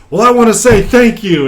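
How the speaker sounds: high, loud officer voice; emphatic commander's thanks. Acted. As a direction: thank them like a commander addressing troops.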